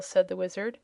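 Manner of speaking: ordinary delivery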